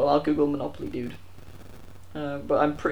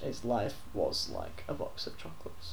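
quiet correction; 7 semitones lower, 13 dB quieter than usual. Real, not acted.

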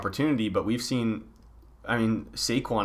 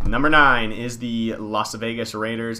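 top ten countdown; 6 dB louder, slower than usual. Acted. Announces power rankings countdown.

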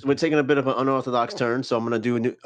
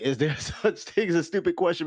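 laughing; 5 semitones higher than usual. Real, not acted. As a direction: chuckle through the title. Repeats title while laughing.

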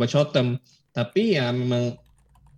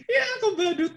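laughing. Real, not acted. Laughing at a clown joke.

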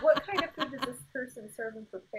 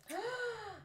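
surprised gasp; 10 semitones higher, 9 dB quieter than usual. Real, not acted. Aunt's post read out.

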